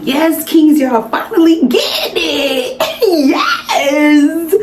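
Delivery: high, excited cheer with laughter; whooping yes kings. Retold or imitated.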